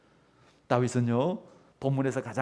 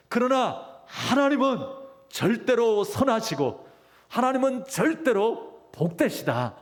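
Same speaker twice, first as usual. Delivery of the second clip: raised, emphatic declaration of faith; fervent preaching; 6 semitones higher than usual. Acted. Preacher voicing a believer's trust that God is good, fervent. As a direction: proclaim it high with full conviction.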